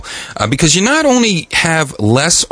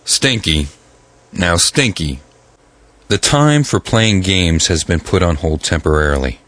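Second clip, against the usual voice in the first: stern low voice; 10 semitones lower than usual. Acted. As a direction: scold him like a stern teacher. Stern scolding of Stinky.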